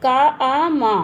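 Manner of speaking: काम split into का, आ, मा; three distinct sounds, का, आ, मा. Shown as a demonstration. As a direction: say each sound separately: का, आ, मा. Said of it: "काम में ध्वनियाँ हैं तीन".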